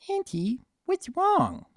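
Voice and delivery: a small colt worried for his aunt. Acted. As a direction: ask anxiously like a little colt.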